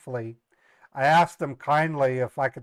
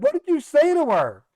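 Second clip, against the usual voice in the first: brother's alarmed question; 13 semitones higher, 6 dB louder than usual. Retold or imitated. Brother asks on the phone.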